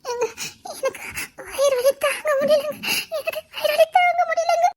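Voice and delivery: high, tearful cries of pain; crying in pain. Acted. Wife in labour begging her husband, crying in pain. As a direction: sob out the words through labour pain.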